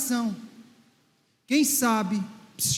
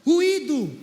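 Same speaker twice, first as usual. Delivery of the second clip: loud repeat; 3 dB louder than usual. Shown as a demonstration. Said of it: "bota a voz pra fora".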